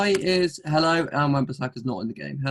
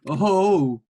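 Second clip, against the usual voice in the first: loud, higher drawn-out "ohh"; surprised "oh"; 5 semitones higher, 6 dB louder than usual. Real, not acted. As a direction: react to the pick with a big "oh". Reacts to goalkeeper pick.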